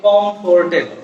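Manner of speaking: কমফোর্টেবল with a full "for", "kom-for-te-bol"; common mispronunciation. Shown as a demonstration. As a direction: sound out the o and r in কমফোর্টেবল. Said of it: "আসলে শব্দটা কমফোর্টেবল না". (ভুল উচ্চারণে কমফোর্টেবল, "ফোর" পুরোটা বলে)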